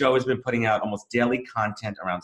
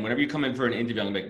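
ordinary talk

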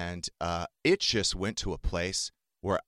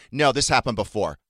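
raised, louder retort; retold protest; 4 semitones higher, 7 dB louder than usual. Daughter retells arguing with dad on the phone, raised insistent protest. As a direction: cut in firmly, louder and higher.

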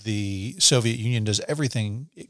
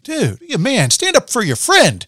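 higher, louder mock rebuke; a hint of an impatient boss telling him off; Stalin scolding the writer on the phone, louder and higher; 11 semitones higher, 6 dB louder than usual. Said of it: "He's like".

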